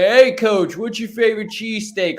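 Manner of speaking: put-on loose Philly accent, reporter asking. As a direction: mimic a Philly interviewer. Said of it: "just doing a Philly accent".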